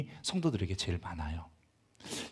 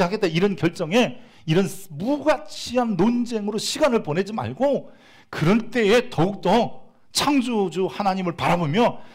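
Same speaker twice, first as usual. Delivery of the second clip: high, loud fervent preaching; much higher, louder sermon climax, slowing at the end; climactic preaching; 10 semitones higher, 6 dB louder than usual. Acted. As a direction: build to a passionate peak.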